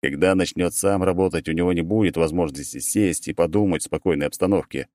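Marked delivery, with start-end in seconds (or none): none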